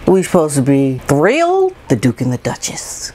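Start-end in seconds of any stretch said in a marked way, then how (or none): 1.9-3.1 mock awed whisper. Mocking awed Americans.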